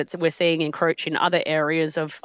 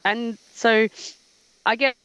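breaking up phone line; glitching audio; 5 semitones higher than usual. Real, not acted. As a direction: voice cutting out on a bad call. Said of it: "Whoop, you're gone again".

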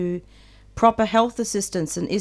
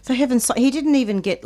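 higher and louder, appalled; exasperated disbelief; 6 semitones higher, 4 dB louder than usual. Real, not acted. Radio host appalled that the prisoner got no CPR, exasperated. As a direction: let the outrage lift the voice.

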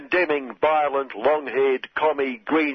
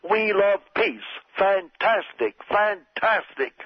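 light Trump voice pitched up, slow on fantastic; Trump impression; 6 semitones higher, slower than usual. President praising supremacists, a mock-delighted Trump voice. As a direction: savour each fantastic like a salesman. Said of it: "Donald does use fantastic quite a lot".

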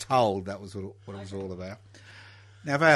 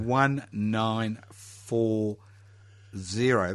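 very slow, drawn out; much slower than usual. Pretend. Host echoes year slowly.

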